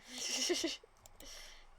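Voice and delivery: short bright laugh at her pun; laughing at own joke. Real, not acted. After a terrible pun to viewers, laughing.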